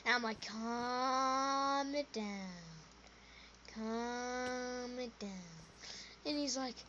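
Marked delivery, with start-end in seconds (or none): 0.4-2.2 very slow, drawn-out calming; long, easy mock-soothing; soothing hush. Soothing the crying friend. 3.7-5.3 soft, very slow and drawn out; hushed mock-calming; soothing hush. Soothing the crying friend again.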